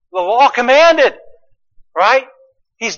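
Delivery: ordinary delivery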